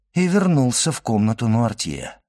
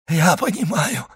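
higher, pained outburst; anguished exclamation; 6 semitones higher than usual. A narrator's reading. Dying man understands his end, anguished. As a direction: exclaim it in a raised, suffering voice. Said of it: "воскликнул несчастный".

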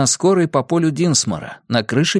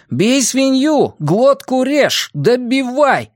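high, slow chanted war cry; цитата-клич; 12 semitones higher, much slower than usual. A narrator's reading. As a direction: chant it as a quoted savage cry, each phrase drawn out. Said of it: "процитировал Бенни". (протяжный высокий боевой клич)

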